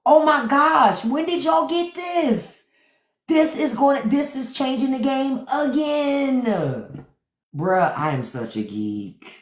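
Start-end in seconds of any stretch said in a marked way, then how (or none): 0.0-7.1 much higher, slow, giddy awe; geeking out. Retold or imitated. Asking the dentist about the new tool, giddy and high. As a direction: gush to the dentist like a kid. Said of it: "I was geeking out so bad".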